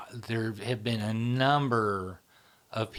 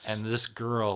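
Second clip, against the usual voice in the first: normal talking voice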